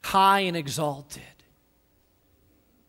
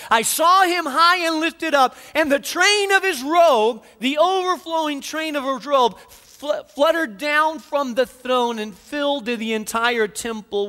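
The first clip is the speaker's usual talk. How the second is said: fervent sermon climax; rising preaching; 6 semitones higher than usual. Acted. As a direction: lift the congregation with a soaring proclamation. Preacher rising to full force.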